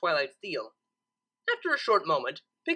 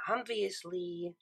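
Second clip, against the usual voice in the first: softer, slow muttered aside; 8 dB quieter, much slower than usual. A narrator's reading. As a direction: grumble under breath. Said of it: "muttered Twilight".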